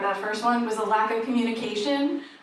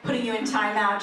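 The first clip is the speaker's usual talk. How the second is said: normal talking voice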